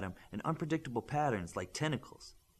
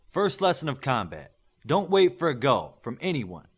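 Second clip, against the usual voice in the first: loud coach's bark across the pit; coach shouting; 3 semitones higher, 8 dB louder than usual. A narrator's reading. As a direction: shout the rule at the whole group. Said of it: "Smith shouted".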